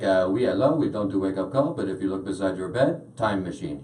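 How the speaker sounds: put-on low, soft Montreal French accent. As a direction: imitate a French-speaking hotel clerk. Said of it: "the guy on the other line was like".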